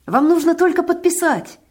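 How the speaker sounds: high, loud, eager urging; joyful exclamation. A narrator's reading. Fat man pressing a paper on old woman, eager exclamation. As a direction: urge them brightly to sign. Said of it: "радостно воскликнул он".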